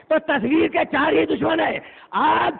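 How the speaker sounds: rising sermon crescendo; full-force preaching. Acted. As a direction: drive each line home to the gathering.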